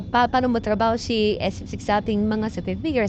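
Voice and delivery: ordinary talk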